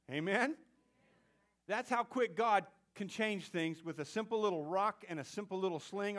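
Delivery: usual speaking voice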